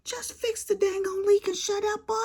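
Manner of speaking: imitating the husband snapping back, high; angry yell. Wife imitates husband yelling at her, high mock yell. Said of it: "And he was raising".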